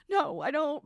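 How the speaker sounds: high, mock-tearful refusal; imitated sobbing. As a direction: answer as if still crying a little. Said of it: "sobbing at her desk".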